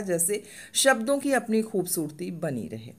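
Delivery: ordinary delivery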